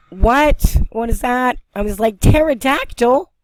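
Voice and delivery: loud, high-pitched startled reaction; thrilled disbelief at a sudden sighting; excited amazement. Real, not acted. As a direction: exclaim it high, climbing to the last word. Reacts to big bird flying over.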